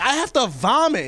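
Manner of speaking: high, loud desperate shout, retold; hammed-up panicked yelling. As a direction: yell it in urgent mock panic. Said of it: "I'm, like, yelling this now".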